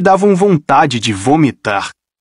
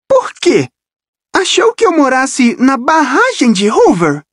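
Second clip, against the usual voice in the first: voz aguda e irônica de menina; garota sarcástica; 11 semitones higher than usual. Acted. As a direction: debocha da pergunta, voz de garota. Rachel sarcástica. (Rachel zomba de Percy, aguda e irônica)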